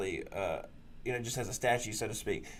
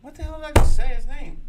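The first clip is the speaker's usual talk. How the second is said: frustrated shout; 14 dB louder than usual. Real, not acted. Frustrated outburst at himself.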